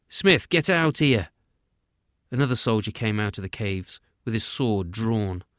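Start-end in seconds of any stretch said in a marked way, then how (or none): 0.1-1.3 calling back. A narrator's reading. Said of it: "called back to the cave".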